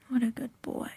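normal talking voice

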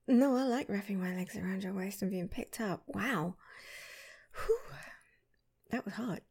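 ordinary talk

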